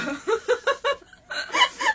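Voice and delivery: very high, loud cackle; big laugh. Real, not acted. Laughing at the friend's sudden laundry line, high cackle. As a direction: burst out laughing.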